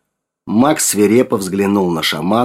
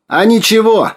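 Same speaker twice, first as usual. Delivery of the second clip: high, loud answering call; calling back; 13 semitones higher, 5 dB louder than usual. A narrator's reading. Sergei answers Max from beyond the lens, calling back loudly. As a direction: call it back across a distance.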